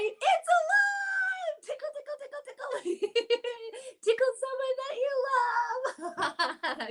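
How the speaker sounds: high, thrilled cry of surprise; excited shout. Pretend. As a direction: burst out with delight as the bees appear. Fingerplay reveal again.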